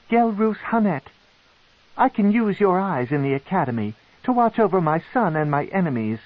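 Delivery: high, imperious drow matron; cold matron. Acted. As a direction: pronounce his fate as a queen.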